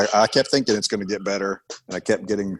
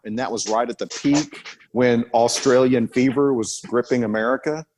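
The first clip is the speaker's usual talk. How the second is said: ordinary talk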